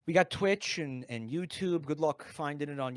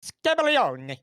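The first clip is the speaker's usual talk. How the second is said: Scabaglione, higher and louder, Italian flair; fake Italian; 8 semitones higher, 9 dB louder, slower than usual. Shown as a demonstration. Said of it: "repeat after me".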